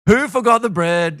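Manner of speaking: loud, high indignant demand; mock grumbling accusation. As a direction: round on your mates, accusing. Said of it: "they're grumbling amongst themselves".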